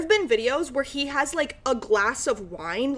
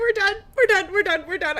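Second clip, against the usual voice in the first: exasperated; 8 semitones higher, 4 dB louder, faster than usual. Real, not acted. Overwhelmed after cringe video.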